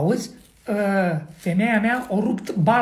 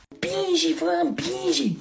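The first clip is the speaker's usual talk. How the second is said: higher, stretched-out urging; urging to push; 5 semitones higher, 3 dB quieter, slower than usual. Acted. Husband urging wife in labour to push, drawn-out urging. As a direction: draw out the command to push.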